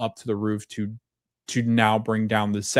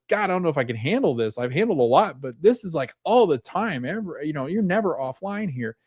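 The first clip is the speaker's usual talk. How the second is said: higher, mock-anxious rambling; overwhelmed; 6 semitones higher than usual. New doctor telling his colleague, higher and mock-anxious. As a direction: act frazzled by the workload. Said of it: "And he goes".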